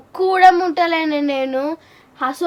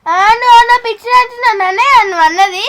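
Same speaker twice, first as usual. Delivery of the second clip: very loud, offended whine; wailing protest; 3 semitones higher, 9 dB louder, faster than usual. Acted. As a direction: protest the insult in a loud tearful voice. Crier protests being called mad. (ఏడుపు గొంతుతో గట్టిగా నిలదీత)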